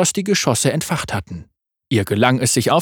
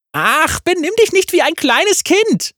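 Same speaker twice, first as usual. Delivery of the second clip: laughing. A narrator's reading. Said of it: "lachte sie".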